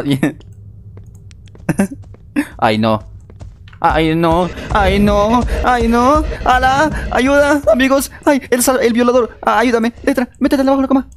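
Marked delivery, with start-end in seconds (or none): 3.8-11.1 rising panic. Real, not acted. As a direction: plead for help in mounting fear. Friend caught, begging for help. (súplicas de ayuda cada vez más agudas y fuertes)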